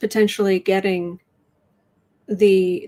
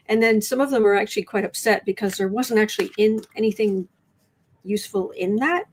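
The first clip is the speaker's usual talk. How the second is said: ordinary talk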